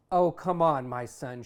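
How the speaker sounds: mother begging, voice jumps up loud; loud pleading mom; pleading loudly. Acted. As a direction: coax him, almost calling out. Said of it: "she begs".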